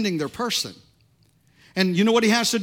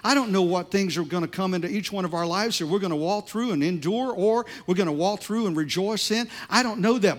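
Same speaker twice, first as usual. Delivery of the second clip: ordinary delivery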